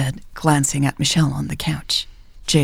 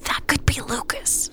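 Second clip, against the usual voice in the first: hurried breathy whisper; urgent resistance whisper; 9 semitones higher, 6 dB quieter than usual. A narrator's reading. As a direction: whisper the plan quickly. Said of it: "Michelle whispered back".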